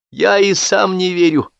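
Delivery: high, slow exclamation; high drawn-out exclamation of disbelief; exclaiming. A narrator's reading. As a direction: cry it out in disbelief. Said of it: "воскликнул Сапфиров".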